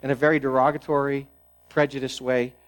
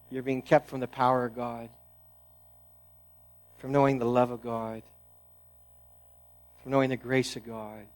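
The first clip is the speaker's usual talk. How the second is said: soft and grave, letting each phrase land; hushed preaching; 3 semitones lower, 8 dB quieter than usual. Real, not acted. Pastor pressing the point to the congregation, hushed and grave. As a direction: drop to a near hush, pausing between phrases.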